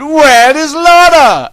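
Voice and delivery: high, slow, loud cry of alarm; urgent call. Acted. Legolas calling down the hall for the missing Lorna, alarmed. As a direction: call out for her, alarmed and drawn out.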